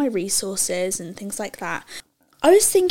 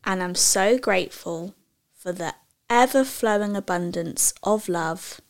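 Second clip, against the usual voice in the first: over enunciating; 2 semitones lower, slower than usual. Shown as a demonstration. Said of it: "I'm gonna say it slowly for you".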